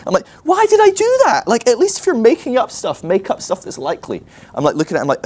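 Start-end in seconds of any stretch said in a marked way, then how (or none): 0.4-4.2 high, loud comic outburst, easing down by the end; exasperated self-reproach. Retold or imitated. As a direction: scold yourself in squeaky dismay. Berating himself in retold panic.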